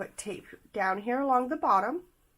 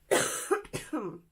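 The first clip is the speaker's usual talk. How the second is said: coughing fit; 8 semitones higher than usual. Real, not acted. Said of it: "I apologize for the coughing".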